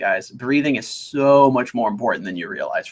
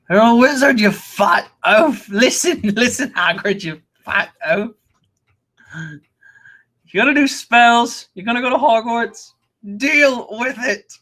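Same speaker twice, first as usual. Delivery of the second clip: high, drawn-out put-on voice; mock Harry Potter parody; 9 semitones higher, slower than usual. Streamer quoting a vulgar parody video to Hagrid, high mock outburst.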